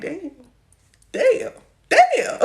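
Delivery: acting out stunned disbelief; mock amazed damn. Acted amazed reaction in a story.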